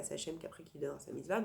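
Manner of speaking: usual speaking voice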